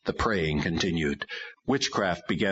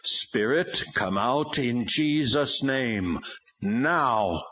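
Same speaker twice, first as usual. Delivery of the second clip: commanding; 5 semitones higher, much slower than usual. A narrator's reading. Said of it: "looked directly into Bobby's eyes and commanded".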